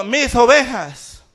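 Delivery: loud, slow, emphatic proclamation; Jesús. Retold or imitated. Preacher quoting scripture to the congregation, loud slow proclamation. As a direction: proclaim the quote with weight, drawn out. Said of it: "Jesús dijo".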